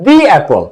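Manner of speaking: 'the apple' with "the" as "dee"; 'the' with a long ee vowel; correct "the". Shown as a demonstration. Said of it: "এখন উচ্চারণটা হচ্ছে".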